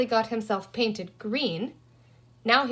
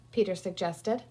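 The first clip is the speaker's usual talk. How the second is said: ordinary delivery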